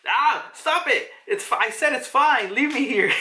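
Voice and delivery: put-on high-pitched, protesting old man in pain; mock pained moaning. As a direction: whine in protest, hammed-up. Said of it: "he's moaning about the pain".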